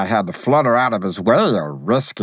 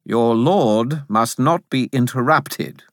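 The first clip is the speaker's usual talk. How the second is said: lightly voiced haughty spirit, pitched up; smug gatekeeper; smug and superior; 4 semitones higher than usual. A narrator's reading. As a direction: correct them with lofty self-importance. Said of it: "in a very smug and superior voice".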